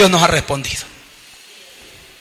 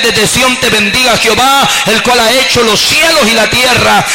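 louder fervent prayer over music, building; oración fervorosa; 3 semitones higher, 4 dB louder than usual. Real, not acted. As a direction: pray aloud with rising fervor to God. (oración en voz alta y ferviente)

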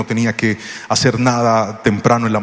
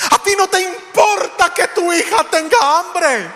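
aguda y lenta, reproche infantil; niña reprochando; slower than usual. Retold or imitated. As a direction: reprocha como niña ofendida. (reproche agudo de la niña)

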